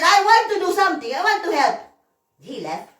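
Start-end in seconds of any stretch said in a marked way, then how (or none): 0.0-1.8 eager retold plea. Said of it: "I said".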